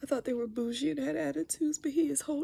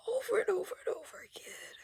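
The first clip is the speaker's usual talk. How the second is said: whispered crying; 6 semitones higher, faster than usual. Real, not acted. Grieving her mother's death.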